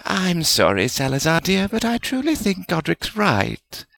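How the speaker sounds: high, gentle character voice; soothing. A narrator's reading. Woman letting a colleague down kindly, soothing. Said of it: "Helga said soothingly".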